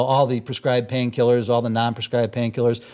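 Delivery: ordinary delivery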